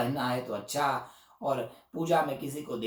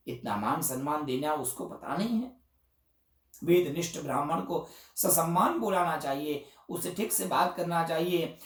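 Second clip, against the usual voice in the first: usual speaking voice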